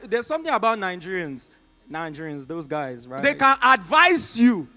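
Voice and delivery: ordinary talk, nothing marked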